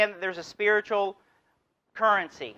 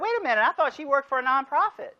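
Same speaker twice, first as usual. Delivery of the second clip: higher, fast mock-irritated aside; 6 semitones higher, faster than usual. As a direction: rattle it off, pitched up and annoyed. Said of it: "you're getting irritated".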